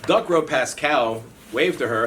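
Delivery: usual speaking voice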